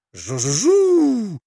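high, long propeller buzz; shouted plane buzz. A narrator's reading. Boys racing off as planes, high buzzing. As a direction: buzz high, holding the last sound. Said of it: "и кричали".

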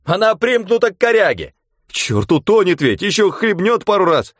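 high, loud alarmed calls; alarmed shouting. Acted. Onlookers on the shore call out in alarm.

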